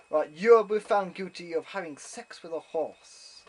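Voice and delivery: loud, mock-official verdict; pronouncing judge. Judge sentencing German visitor, mock-pompous proclamation. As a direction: proclaim the charge to the whole court, imitating a judge.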